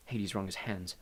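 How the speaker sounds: usual speaking voice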